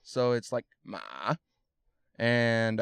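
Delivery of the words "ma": ma dipping down then back up; dipping third tone. Shown as a demonstration. Teaching the third tone, ma dipping down then up. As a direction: let ma fall into a trough and climb out. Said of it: "down and then up".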